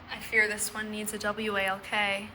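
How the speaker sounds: ordinary talk, nothing marked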